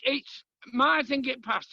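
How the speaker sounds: usual speaking voice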